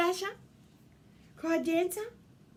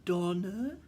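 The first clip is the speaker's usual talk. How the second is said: plain reading voice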